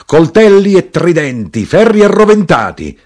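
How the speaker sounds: loud, high rallying cry; shouted. A narrator's reading. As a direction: shout out the list like a battle cry. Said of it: "l'arrotino gridò".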